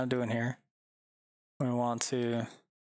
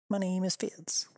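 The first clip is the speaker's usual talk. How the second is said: higher, cartoon little-dog voice; squeaky dog voice; 7 semitones higher than usual. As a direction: give the tiny dog a squeaky voice. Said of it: "said twice as the dog".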